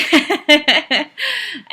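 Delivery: short high burst of laughter; bright laugh. Real, not acted. Laughing at herself before getting back to her story.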